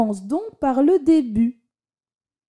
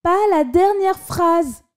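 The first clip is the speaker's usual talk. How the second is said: high pleading, stressing dernière; child begging; 7 semitones higher than usual. A narrator's reading. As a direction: beg like a desperate small boy. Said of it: "supplia le petit".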